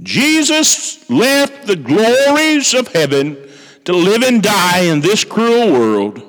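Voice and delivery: high, slow, solemn preaching. Acted. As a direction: proclaim it slowly from the pulpit. Preaching opening of sermon.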